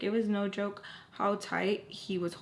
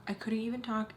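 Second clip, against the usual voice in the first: ordinary delivery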